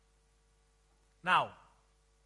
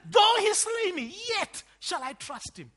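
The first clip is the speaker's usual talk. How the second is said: high, shouted opening, easing by the end; quoting Job; 12 semitones higher, 10 dB louder than usual. Acted. Preacher repeating Job's words, high and loud declamation. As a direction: cry it out slowly as Job, then settle. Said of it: "Jo-, Job says".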